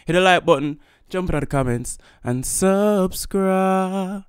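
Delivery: slow, drawn-out subscribe call; drawled subscribe outro; outro plug. Real, not acted. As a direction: stretch out subscribe like a sign-off. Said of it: "drawn-out outro call to subscribe".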